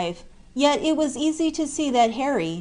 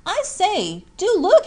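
high and louder, calling out eagerly; eager cry; 10 semitones higher, 4 dB louder than usual. A narrator's reading. Jack calling gloomy Harry to look, eager cry. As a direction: call out to your friend with excitement. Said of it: "cried Jack".

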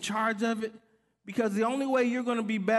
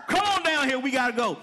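calling out; 3 semitones higher, 4 dB louder than usual. Retold or imitated. Waving cars through, calling out.

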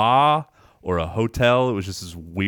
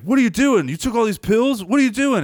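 alarmed demand; 13 semitones higher, 3 dB louder than usual. Retold or imitated. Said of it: "he's like".

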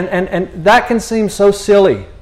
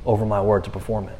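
calm reassurance; 7 semitones lower, 8 dB quieter than usual. Retold or imitated. God answering Jeremiah, calm.

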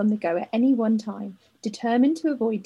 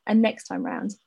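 ordinary talk, nothing marked

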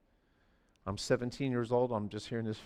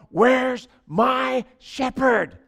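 mock panic; 11 semitones higher, 12 dB louder, much slower than usual. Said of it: "he's gonna go".

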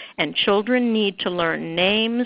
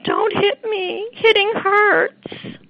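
much higher, slow, whimpering "Don't hit me, hitting hurts."; mock timid "Don't hit me"; weak, whiny version; much slower than usual. Shown as a demonstration. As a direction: plead it like a teary child with no force. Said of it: "That's not how those words sound".

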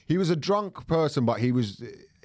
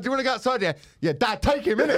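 higher, giggly tough talk; mock challenge, laughing; 11 semitones higher, 3 dB louder, faster than usual. Retelling the fight offer to friends, laughing mock bravado. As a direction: play the hard man through laughter.

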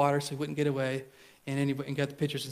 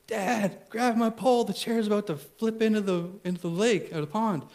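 urgent son; 6 semitones higher than usual. Retold or imitated.

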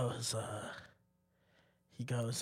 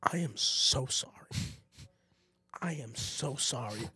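apologetic teacher; 3 semitones lower, 6 dB quieter than usual. Retold or imitated. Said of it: "and he just goes".

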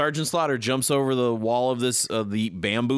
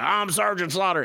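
higher, proud announcement; mock hero line; 7 semitones higher than usual. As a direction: announce yourself like a cartoon hero. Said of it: "and he's like".

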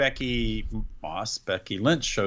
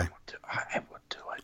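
whispering; 14 dB quieter, much faster than usual. Real, not acted. Quiet confession to co-host.

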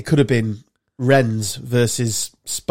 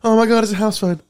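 high, excited imitation of a man reacting to a house phone; mock shocked guy; 7 semitones higher than usual. Retelling an Instagram video to mates, a high shocked mock voice. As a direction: squeal it out like he just felt ancient. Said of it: "And then the guy's like".

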